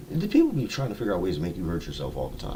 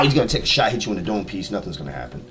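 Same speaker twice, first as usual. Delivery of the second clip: usual speaking voice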